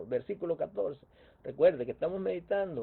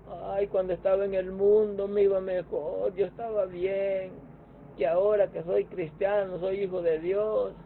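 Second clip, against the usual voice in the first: higher, louder whiny griping; hammed-up crybaby lament, a bit higher; mock whining complaint; 4 semitones higher, 5 dB louder than usual. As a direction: mimic a believer moaning about their life. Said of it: "y comienzan a llorar".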